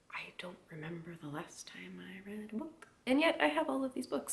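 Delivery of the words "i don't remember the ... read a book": whisper. Retold or imitated. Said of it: "be like".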